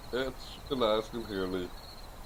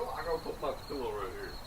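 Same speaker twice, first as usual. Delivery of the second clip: ordinary talk, nothing marked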